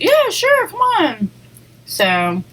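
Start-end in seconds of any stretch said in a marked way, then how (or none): 0.0-1.3 eager yes. Retold or imitated. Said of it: "And they're like".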